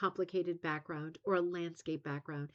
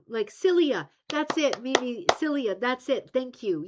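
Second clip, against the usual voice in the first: loud, high, delighted relief at finding the word; excited eureka; 5 semitones higher, 8 dB louder than usual. Real, not acted. Host thanks viewer Mimi for the word, loud and thrilled. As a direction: cry out the word you've been hunting for.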